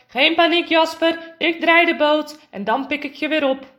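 loud, urgent reassurance; calling out. Acted. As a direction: call across the water to your son. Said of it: "Hij hoort zijn vader roepen".

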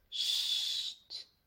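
soft drawn-out shush; quiet "sst" through the teeth; hushing. Acted. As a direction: hush your friend without waking anyone. Finger on lips, fathers asleep.

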